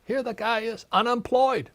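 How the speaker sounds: high, loud exasperated disbelief; retelling how he protested to his unemployed father, high, loud and exasperated. Retold or imitated. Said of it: "I said".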